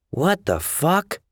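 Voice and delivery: high, drawn-out disbelief; blurted out. A narrator's reading. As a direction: blurt it in baffled shock. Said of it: "she blurted".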